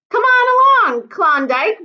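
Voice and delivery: very high, loud chortling call. Acted. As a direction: call her along while huffing past. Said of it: "Bertha chortled as she huffed past".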